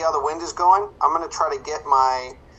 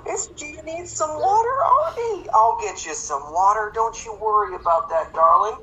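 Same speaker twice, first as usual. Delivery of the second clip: much higher, sugary fake-tender voice to a child; sudden sweet parent voice; 13 semitones higher than usual. Dad soothing his child out of bed, high sugary tenderness. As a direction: switch instantly to a syrupy gentle daddy. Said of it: "And then his kids come in and he's like".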